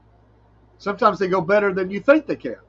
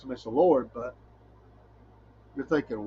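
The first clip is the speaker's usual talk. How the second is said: ordinary talk, nothing marked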